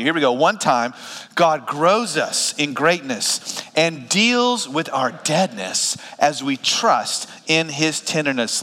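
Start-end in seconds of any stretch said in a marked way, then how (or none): 1.3-8.6 slow, spaced phrases for reading along. Shown as a demonstration. Pastor leading the congregation reading aloud together, slow read-along. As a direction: lead the room through it slowly, phrase by phrase. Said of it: "read this out loud with me".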